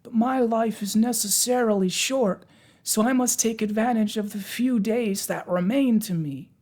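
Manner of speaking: high, slow, mournful Pumpkinhead; gloomy. A narrator's reading. Pumpkinhead bemoaning his short life, gloomy. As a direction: lament slowly in a high-pitched voice. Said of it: "said the Pumpkinhead gloomily".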